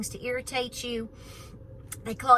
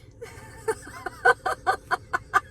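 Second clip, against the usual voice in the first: high squealing laugh. Real, not acted. Laughs at her own joke.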